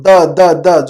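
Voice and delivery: د as short repeated "da, da, da"; isolated letter sound. Shown as a demonstration. Teacher showing the tongue takes part, د repeated as "da". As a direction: tap out د three times with the tongue. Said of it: "زبان شامل ہے اس میں".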